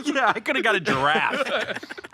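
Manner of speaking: laughing at his own story. Real, not acted. Joking with the podcast host, laughing. Laughing over the coke joke.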